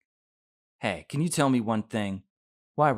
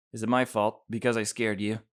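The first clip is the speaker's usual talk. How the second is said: ordinary talk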